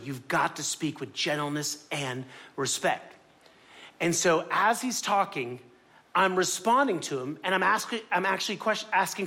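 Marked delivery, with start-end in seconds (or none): 0.0-3.1 slow, soft, lower-pitched counsel; gently slow it down to settle someone; calming reminder. Retold or imitated. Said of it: "I calm down".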